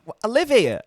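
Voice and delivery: high, loud indignant reply; exasperated yell. Retold or imitated. To the passport officer, indignant and loud. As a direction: throw it back at the officer, fed up. Retelling passport control exchange.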